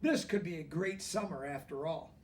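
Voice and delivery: usual speaking voice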